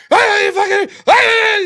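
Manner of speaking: high, loud mock drunken yell. Drunk friend flaring up at the group, loud mock yell. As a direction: bellow it like an angry drunk buddy. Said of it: "start yelling and shit".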